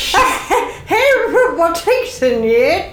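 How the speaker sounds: put-on high, slow, creaky old lady. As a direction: play a doddery granny fretting over her mail. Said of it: "I can do a very good old lady".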